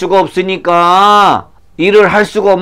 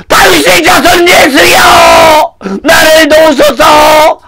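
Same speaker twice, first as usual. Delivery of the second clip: very high, wailing plea; louder desperate shouting; 12 semitones higher, 5 dB louder, slower than usual. Acted. Blind man refusing to hush, desperate shouting. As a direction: cry out over the objections. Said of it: "더 크게 소리쳤어요".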